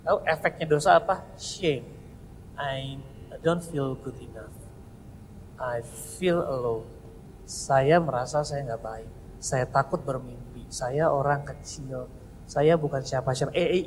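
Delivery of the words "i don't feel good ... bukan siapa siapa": low, quiet, small ashamed voice; ashamed inner voice. Acted. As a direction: speak as someone who feels worthless. Voicing the ashamed sinner's thoughts.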